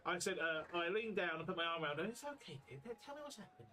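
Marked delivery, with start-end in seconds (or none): 2.1-3.7 soft, much higher, tender coaxing; gentle comforting. Acted. Trix leans down to comfort Frida, soft and high. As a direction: soothe her like a frightened child.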